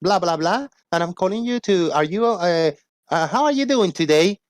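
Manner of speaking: high, sing-song telemarketer imitation; scam-call recording. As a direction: mimic a perky automated sales call. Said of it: "it's a recording message".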